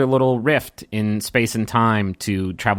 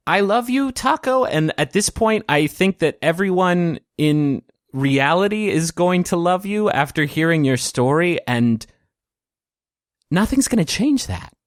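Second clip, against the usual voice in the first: heartfelt declaration; 7 semitones higher than usual. Acted. Kravitz reassures Taco of his love, heartfelt. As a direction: declare it sincerely, voice lifted and unhurried.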